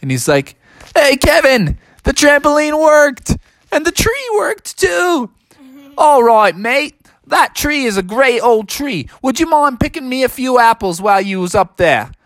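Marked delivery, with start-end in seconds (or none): 1.0-5.3 high cartoon holler; triumphant high-pitched shout-out from a treetop; calling from afar. Bubby calls from the tree. 6.0-12.1 high, hammed-up Aussie kangaroo; put-on Australian accent, higher voice. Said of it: "your Australian accent sure is awesome".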